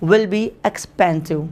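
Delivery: slow dictation. Shown as a demonstration. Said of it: "petrol महंगा होगा".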